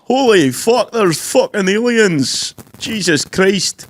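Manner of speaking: high, slow attempt at a Scottish accent; panicked Scottish accent, drawn out.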